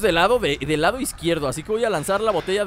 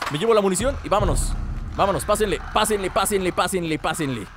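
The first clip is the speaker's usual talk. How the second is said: ordinary delivery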